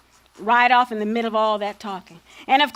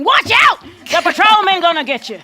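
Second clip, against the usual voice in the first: sudden loud scream of warning; 9 semitones higher, 11 dB louder than usual. Retold or imitated. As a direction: scream to make them jump. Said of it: "And then I scream".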